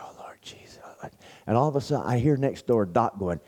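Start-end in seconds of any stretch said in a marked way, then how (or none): 0.0-0.8 breathy whispered prayer under the covers. Retold or imitated. Praying alone in bed at night, whispered. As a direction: whisper it so the roommate won't wake. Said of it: "and I was just whispering".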